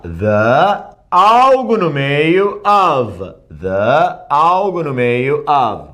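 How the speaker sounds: very slow, drawn-out teaching pattern; slow demonstration. Shown as a demonstration. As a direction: stretch out "the" and "of" as frame words.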